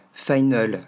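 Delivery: FINAL as "fai-neul"; "final" ending in a falling "eul"; mistaken E ending. Shown as a demonstration. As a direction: mispronounce "final" with a French "eu" before the L. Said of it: "la faute comme un E".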